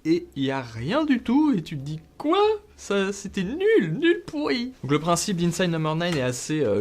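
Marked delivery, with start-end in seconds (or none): 2.2-4.7 high-pitched, drawn-out comic disbelief; mock outrage. Viewer let down by an empty final twist, mock-outraged. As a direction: act out an appalled viewer, slow and climbing high.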